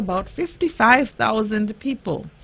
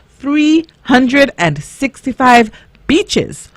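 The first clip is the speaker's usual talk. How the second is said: put-on kids show host; 4 semitones higher, 7 dB louder than usual. Host reads I Spy riddle.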